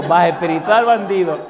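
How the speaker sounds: teasing rebuke with a laugh; laughing scold. Retold or imitated. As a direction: scold the backslider half laughing.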